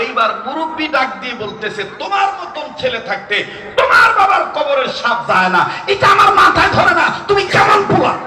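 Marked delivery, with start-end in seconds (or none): 2.0-8.1 higher and louder, rising to a laughing shout; mock an old man berating his son; hammed-up outraged elder; scolding elder.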